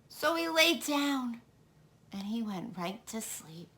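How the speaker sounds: plain reading voice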